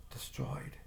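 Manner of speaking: barely whispered, stunned. Acted. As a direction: let the word fall away into breath. Seeing the wrecked house.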